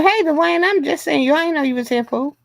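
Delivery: normal talking voice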